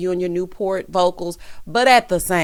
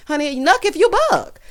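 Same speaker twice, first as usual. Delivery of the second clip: high-pitched, spoken mock dare; animated, pitched-up sass; sassy challenge; 8 semitones higher than usual. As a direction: throw it out as a playful challenge. Repeats the lyric as own challenge.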